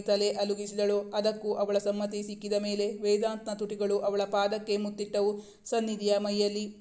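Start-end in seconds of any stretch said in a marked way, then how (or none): none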